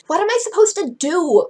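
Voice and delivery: high, loud wail; mock sobbing. Claudine enters the party upset, mock sobbing. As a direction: wail it like hammed-up crying.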